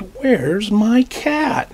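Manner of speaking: frustrated. Acted. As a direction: stretch it out, fed up with searching. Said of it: "He really looks frustrated now".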